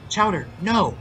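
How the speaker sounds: high-pitched urgent objection; alarmed protest. A narrator's reading. Boy stops friend ringing the doorbell, alarmed. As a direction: call out to stop him.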